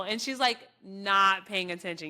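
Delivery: usual speaking voice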